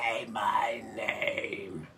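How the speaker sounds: slow raspy demonic whisper; devil voice. Retold or imitated. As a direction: breathe it out like a demon. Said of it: "She sounds like the devil".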